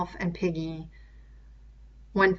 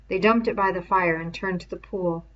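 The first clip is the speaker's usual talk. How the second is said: plain reading voice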